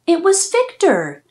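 plain reading voice

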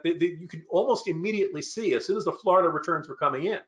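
ordinary delivery

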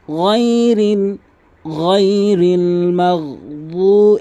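chanted গায়রিল মাগদু with throat gh, long u; throaty ghayn. Shown as a demonstration. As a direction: roll the gh of গায়রিল from the upper throat. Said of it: "গয়নের উচ্চারণ হলকের শেষ থেকে". (গায়রিল-এ হলকের শেষ থেকে গয়ন)

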